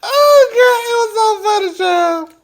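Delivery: high, loud, near-tearful hilarity; overcome with laughter. Real, not acted. Host telling viewers how funny the call was, high hilarious wail. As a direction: wail it out, tickled to pieces.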